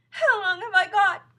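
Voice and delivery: high, loud, slow wail; frightened cry. A narrator's reading. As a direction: beg to know your fate. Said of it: "cried Missus Twit".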